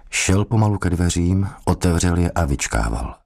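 plain reading voice

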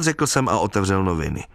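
plain reading voice

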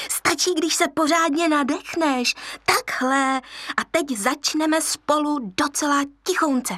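put-on young hyena coaching her friend; hyena teacher. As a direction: coax the cub like a playful hyena.

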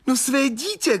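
very high, strained exclamation; distraught cry. A narrator's reading. Young man answers what he expected to see, distraught cry. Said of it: "křičel znovu".

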